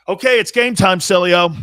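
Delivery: rally him like a coach; loud announcement. Retold or imitated. Doctor calling the dad to the delivery, loud. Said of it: "he goes like this".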